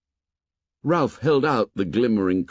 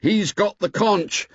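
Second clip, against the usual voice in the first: higher, louder call for order; shouting; 8 semitones higher, 3 dB louder than usual. A narrator's reading. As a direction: shout over the crowd to be heard. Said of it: "Ralph shouted".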